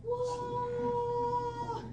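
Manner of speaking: high, soft, long-held "whoa"; hushed, drawn-out crowd "whoa"; mimicked crowd awe. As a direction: imitate an awestruck crowd on one steady note. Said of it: "everybody around me is".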